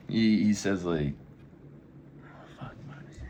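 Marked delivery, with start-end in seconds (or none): 2.6-3.3 muttering to self. Real, not acted. Trying to remember, to himself.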